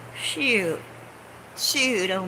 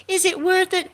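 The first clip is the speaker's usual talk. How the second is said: mock protester shout; 10 semitones higher, 4 dB louder than usual. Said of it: "for those people shouting".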